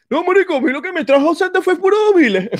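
high, loud whiny put-on voice; squeaky mocking impression of a buddy. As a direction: parody a grumpy friend in a squeal. Mocking Santi's bad-vibe answer.

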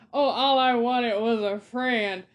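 a lightly whiny, sarcastic villain plea; mocking sob story. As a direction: parody the villain's self-pity. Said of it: "how are you gonna make this man have redeemable qualities".

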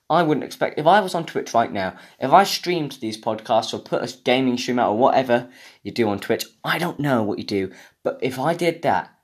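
ordinary delivery

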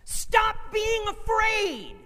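high, loud shouted rebuke from God; commanding shout. Acted. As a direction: shout it as if shaking someone out of panic. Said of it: "he grabs us and he shakes".

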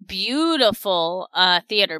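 ordinary talk

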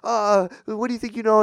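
hammed-up arguing; mock yelling. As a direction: imitate a bickering driver. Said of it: "they're just yelling at each other".

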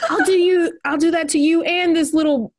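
high, laughing mock threat. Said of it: "And they're like".

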